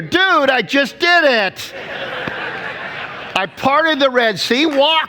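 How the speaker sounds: high, loud and slow, fed-up Jesus voice; a loud, high, slowed-down Jesus who just parted the sea; exasperated Jesus. Retold or imitated.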